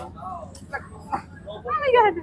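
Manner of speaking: high, drawn-out excited squeal; kilig squeal. Real, not acted. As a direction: squeal it out like a swooning fan. Gushing over the scene. (kinikilig na tili, mataas at mahaba)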